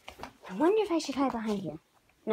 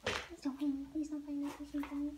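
quiet pleading; 2 semitones higher, 9 dB quieter than usual. Real, not acted. Hiding plea, soft.

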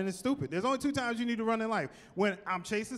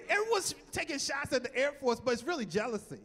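normal talking voice

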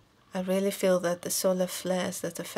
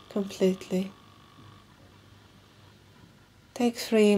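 slow, measured meditation guide with long pauses; guided meditation; 6 dB louder than usual. Acted. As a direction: guide them calmly through each breath, leaving room between phrases.

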